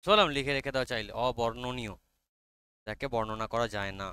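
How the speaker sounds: usual speaking voice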